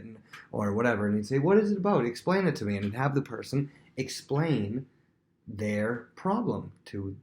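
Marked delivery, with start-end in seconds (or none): 1.4-2.7 higher, louder urging; eager prompting. Acted. Prince urging a visitor to explain, eager prompting. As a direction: press for the story, pitched up. Playing Absalom urging the complainer.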